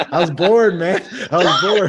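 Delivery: laughing through the words. Real, not acted. Guest laughing at being called a show off. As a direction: laugh it out, caught showing off. Laughing while admitting boredom.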